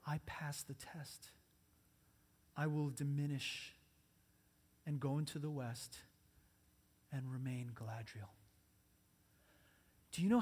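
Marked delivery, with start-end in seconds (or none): 0.1-8.3 humbled queen softly giving up power; soft and sad. Acted. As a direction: let each phrase fall quietly, with long pauses. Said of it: "whose gentle voice was soft and sad".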